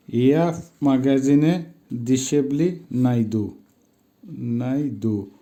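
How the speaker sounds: slowly, each word set apart by pauses; measured word-by-word pronunciation demo; slow teaching repetition. Shown as a demonstration. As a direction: model yeah, মাগাজিনে, দিশেবলি, নাইদু one at a time. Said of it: "উচ্চারণ হবে".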